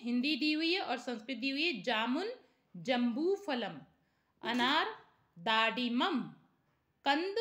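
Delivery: ordinary talk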